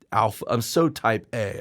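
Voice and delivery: usual speaking voice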